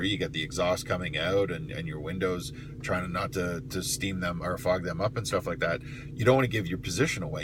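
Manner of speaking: normal talking voice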